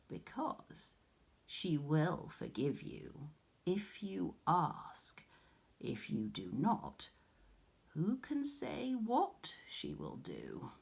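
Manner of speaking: soft, slow unvoiced warning; whispered warning. A narrator's reading. Old crone warning Arachne of Athene, whispering. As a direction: lean in and breathe the threat.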